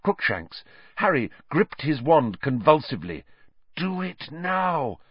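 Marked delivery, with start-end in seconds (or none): 3.8-5.0 higher, slow, soft urging; voice in his head. A narrator's reading. A voice inside Harry urges him on, slow and insistent. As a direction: inner voice pressing him to act. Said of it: "said a voice in his head".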